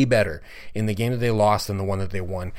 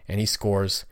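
usual speaking voice

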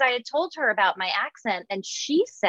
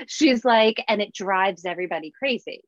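ordinary delivery

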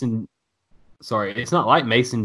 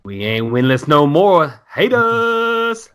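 slow taunt rising to a high, drawn-out yell; defiant taunt; 6 semitones higher, 5 dB louder, much slower than usual. Acted. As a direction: stick it to them, stretching out the last words. Defiant fan message acted out.

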